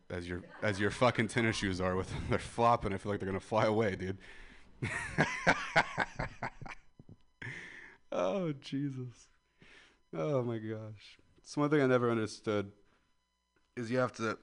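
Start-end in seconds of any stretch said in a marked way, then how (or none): 4.8-6.8 a high shrieking laugh; high squealing laugh. Real, not acted. Big laugh. 8.1-11.2 slow breathless recovery. Real, not acted. Recovering from laughter.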